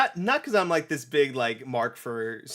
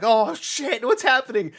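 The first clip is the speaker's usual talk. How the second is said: higher, louder startled cry; higher, louder mock alarm; mock surprise; 11 semitones higher, 8 dB louder than usual. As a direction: gasp it out as Sonic. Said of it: "it's like".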